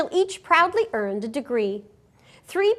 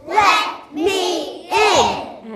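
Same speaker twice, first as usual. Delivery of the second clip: loud, high, slow wolf calling; 6 semitones higher, 6 dB louder, much slower than usual. Acted. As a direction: call out slowly as the wolf. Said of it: "And he says".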